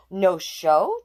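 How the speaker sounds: startled, punchy "No show?"; surprised exclaim. Acted. Tiana hearing the show is off, surprised. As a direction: catch the surprise in two words. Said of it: "Tiana exclaimed".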